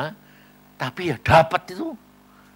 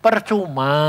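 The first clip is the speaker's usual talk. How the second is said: preaching; 2 semitones higher, 4 dB louder than usual. Acted. Preacher addressing congregation.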